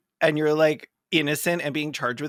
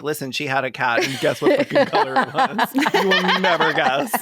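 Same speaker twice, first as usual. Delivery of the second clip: mock gossipy witness. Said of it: "establish her cred by being like".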